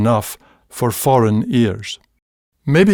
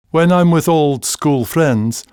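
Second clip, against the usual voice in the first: usual speaking voice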